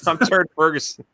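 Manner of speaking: talking with gum in mouth; words round a wad of gum; chewing gum. Real, not acted. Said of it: "So I got gum in my mouth".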